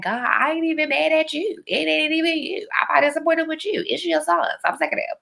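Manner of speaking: high, put-upon plea; mock complaint. Telling God she's sick of his sons, mock complaint. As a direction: protest in a high voice, playing fed up.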